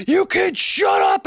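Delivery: put-on high, drawn-out holler at the kids; mock mom yell. Host imitating a mom yelling at her kids, mock shout. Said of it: "mom who's like".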